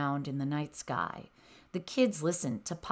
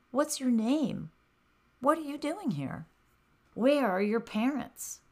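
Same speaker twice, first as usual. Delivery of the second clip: gentle fawn voice; 7 semitones higher than usual. A narrator's reading. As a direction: coax a shy little one. Fawn asks hedgehog gently.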